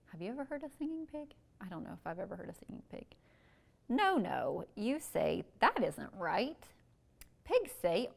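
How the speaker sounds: slow, sing-song picture-book reading; reading to children. Acted. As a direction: read it out slowly for little kids.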